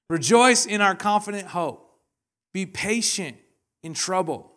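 high, loud, ringing; 1 loud exhortation. Acted. Preacher calling the church to rejoice. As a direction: lift the room with it.

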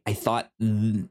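normal talking voice

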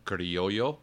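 Spanish pronunciation of Criollo, rising at the end; Spanish accent. Shown as a demonstration. Teaching the co-host a seed name, Spanish accent. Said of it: "do it with a Spanish accent".

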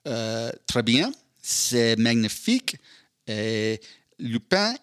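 a slow mock review with a French accent; hammed-up French accent. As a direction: savour each French word, unhurried. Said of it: "my review en français".

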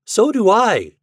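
loud, high, eager agreement; chiming in. A narrator's reading. Hopi Smith also wants a turn, chiming in. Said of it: "chimed in Hopi Smith".

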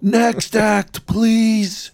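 high sing-out dismissal; mock heckling. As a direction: call it out like an impatient heckler. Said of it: "Somebody just yells".

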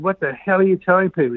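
high, fast, indignant demand; retold anger. Calling the office to confront them, retold fast indignant demand.

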